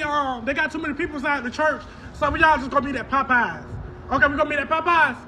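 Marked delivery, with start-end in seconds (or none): none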